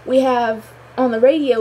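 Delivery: ordinary delivery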